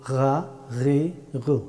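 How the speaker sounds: "গা, গি, গু" drawn out as throaty gha, ghi, ghu; slow upper-throat friction on "গা, গি, গু"; correct Arabic ghayn. Shown as a demonstration. Teacher showing the right way, slow throaty ghayn. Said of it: "এইভাবে বলতে হবে".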